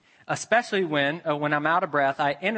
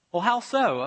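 higher, eager stranger's questions; curious questioner; 8 semitones higher, 5 dB louder than usual. Retold or imitated. Student asking the pastor about churches, high and curious. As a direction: lift into a keen questioner.

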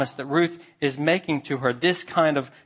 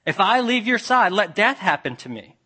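higher, louder, raised to a pleading cry; solemn loud oath; 7 semitones higher, 5 dB louder than usual. Retold or imitated. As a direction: swear it with your whole heart, voice lifted. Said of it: "She's even invoking a covenant curse".

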